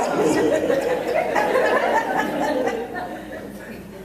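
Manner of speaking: high, soft held-in laugh; imitated chuckle. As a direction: laugh high and quiet, holding it in.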